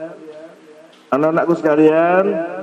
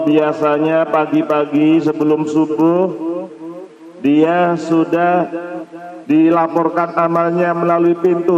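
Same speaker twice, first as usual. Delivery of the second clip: usual speaking voice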